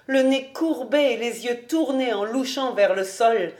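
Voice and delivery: ordinary delivery, nothing marked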